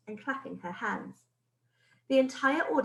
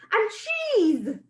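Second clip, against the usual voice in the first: high, loud excited echo; thrilled witch chorus; excited high cry; witches crying out; 12 semitones higher, 7 dB louder than usual. Acted. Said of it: "cried the witches".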